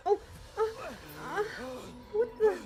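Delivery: real panicked stammer; startled fright. Real, not acted. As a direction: gasp it out in shock. Sudden fright.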